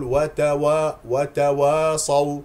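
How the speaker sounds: "وتواصوا" with a dark, backed "وا"; mistaken heavy و. Shown as a demonstration. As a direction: wrongly thicken the و and alif in "وتواصوا". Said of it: "يستعلي الواو والألف التي قبل الصاد". (نطق "وتواصوا" خطأً بتفخيم الواو)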